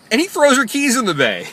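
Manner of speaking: loud, high-pitched storytelling punchline; incredulous climax. Real, not acted. Driver revealing the climax to viewers, loud high disbelief. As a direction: hit the payoff in high amazed disbelief.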